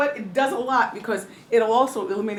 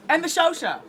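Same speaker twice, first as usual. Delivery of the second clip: higher, louder, fast host farewell; hammed-up show sign-off; 8 semitones higher, 7 dB louder than usual. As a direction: wrap up the show like a bubbly TV host.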